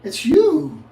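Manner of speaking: high, loud, slow mock admirer; mock flirty woman. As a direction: ham up her cooing reply. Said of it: "And they say".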